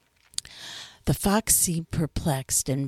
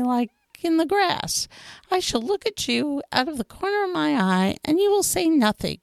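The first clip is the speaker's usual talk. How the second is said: higher fox voice, earnest and pleading; fox character voice; 10 semitones higher than usual. A narrator's reading. Fox explains to the little prince what taming means, higher and earnest.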